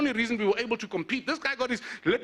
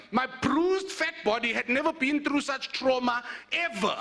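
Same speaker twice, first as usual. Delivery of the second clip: ordinary delivery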